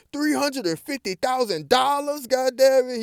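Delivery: high, loud astonished yelp; shocked Eddie. Retold or imitated. Eddie misreads the money, shocked disbelief. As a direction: Eddie doubles it, amazed and dumb. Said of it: "gonna bust out and say".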